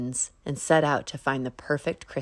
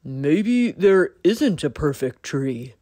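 slow, louder, doubtful deer; 6 dB louder, slower than usual. A narrator's reading. Deer gently doubts Bunny's search, slow louder. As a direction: muse it slowly as the deer. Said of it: "said Deer".

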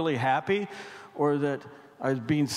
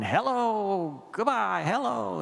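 put-on high, sing-song old man; a lightly voiced rambling old man; 5 semitones higher, slower than usual. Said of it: "he was going".

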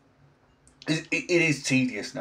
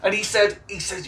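usual speaking voice

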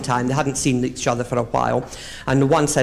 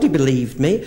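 ordinary talk, nothing marked

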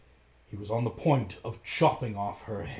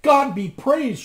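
much higher, louder, slow; joyful cry; 14 semitones higher, 11 dB louder, slower than usual. A narrator's reading. Sister Anne crying out in joy at the brothers. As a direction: cry out in relief. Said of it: "she cried".

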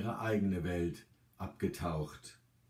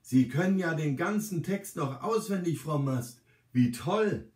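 higher-pitched, admiring call; delighted exclamation; 6 semitones higher, 3 dB louder than usual. A narrator's reading. Carer praises resident for knowing every verse, delighted exclamation. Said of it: "rufe ich".

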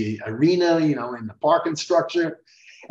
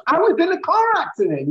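much higher wounded insistence; upset insistence; 11 semitones higher than usual. Retold or imitated. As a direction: insist like someone deeply hurt. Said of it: "he was so upset".

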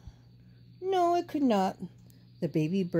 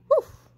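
woof; 6 dB louder than usual. A narrator's reading. Dog barks in story.

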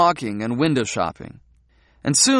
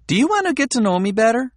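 Midori's voice; 10 semitones higher, faster than usual. A narrator's reading. Midori's voice, high and fast.

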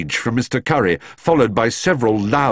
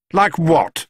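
sharp-eared, higher suspicious question; 8 semitones higher, 4 dB louder than usual. A narrator's reading. Mister Curry questioning the bear, suspicious. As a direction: pounce on it with suspicion. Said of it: "asked Mister Curry suspiciously".

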